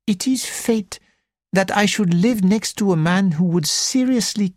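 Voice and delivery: put-on high, lilting French-accented gentleman; a fussy Belgian detective, high and precise; French accented little detective. Porrett speaks.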